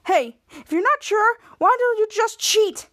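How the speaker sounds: high, needling mock suggestion; acted accusing jab. Henry goading Bianca when she is unsure, accusing. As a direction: needle them, pitch climbing.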